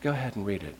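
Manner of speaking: gently urge, right in the ear; whisper. Retold or imitated. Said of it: "that whisper of his ear".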